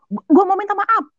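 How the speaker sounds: high, pushed pleading; wide-eyed insistence. Retold or imitated. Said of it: "saya gituin".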